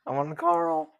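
squeaky puberty crack, hammed up. Shown as a demonstration.

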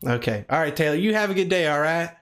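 lightly put-on Southern accent. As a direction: talk like a Southern caller. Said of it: "I like the country accent".

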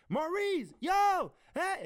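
high, loud calling out; retold shouted hail; yelling. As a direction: holler to get a deaf brother's attention. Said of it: "I have to yell at him every time we talk".

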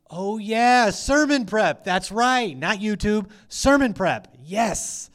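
loud, high, hammed-up "oh, right!"; sudden mock realization. Preacher acting out his reply to Jesus's reminder, hammed-up realization.

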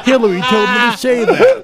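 loud, high presidential impression; Bill Clinton. Guest topping off his impersonation with a joke, presidential voice. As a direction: deliver the punchline as a loud mock president. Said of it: "Yes, Bill Clinton".

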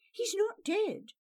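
high, firm refusal; defiant. A narrator's reading. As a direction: refuse to believe it. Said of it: "Istrid sounded defiant".

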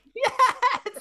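shrieking laugh, pitch way up; high burst of laughter. Real, not acted. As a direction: cackle out loud in delight. Laughs at barnacle joke.

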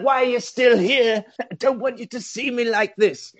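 high melodramatic pleading; hammed-up tragic film hero; mock anguish. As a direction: play a doomed friend. Acts Ken's anguished reply.

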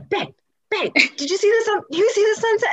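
high-pitched, eager re-enactment; excited calling out. Retold or imitated. Calling a partner to see the sunset, excited. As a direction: act out calling someone over excitedly, voice way up.

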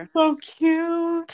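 high, slow adoring coo; gushing. Real, not acted. Listener fawns over the pretend castle rescue, gushing. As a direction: coo over something adorable.

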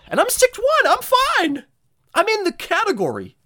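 high, loud exasperated rant; indignant outburst. Real, not acted. Ranting about height, pitch way up.

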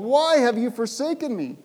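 high, loud cry of abandonment; anguished cry. Acted. Jesus on the cross crying out in anguish. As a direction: wail the question up high.

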